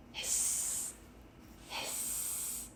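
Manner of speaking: soft steamy hiss, no voice; kettle hiss. A narrator's reading. Tea kettle whistling, a soft breathy hiss. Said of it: "the tea kettle whistled".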